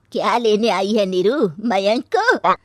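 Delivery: loud, drawn-out accusation; loud, scornful questioning; angry confrontation. Acted. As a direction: challenge an unwelcome daughter-in-law. Mother-in-law confronts returning runaway.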